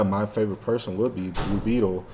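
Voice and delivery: normal talking voice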